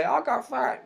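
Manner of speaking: mock complaint. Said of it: "those people who say".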